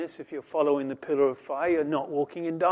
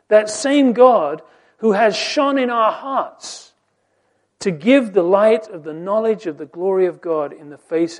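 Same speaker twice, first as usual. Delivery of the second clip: raised, higher voice, pressing each question; preaching at full force; 4 semitones higher, 8 dB louder than usual. Acted. Preacher to his congregation on man's blindness, full-force preaching. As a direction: preach it out, rising with every question. Preacher proclaims God shining.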